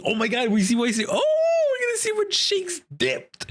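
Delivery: excited high squeal, building; thrilled outburst. Real, not acted. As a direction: burst into a fan's high-pitched hype. Thrilled reaction to the reveal.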